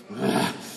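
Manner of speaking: breathy sigh of frustration; frustrated sigh. Shown as a demonstration. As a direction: huff it out in frustration. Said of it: "beginning with frustration, like a".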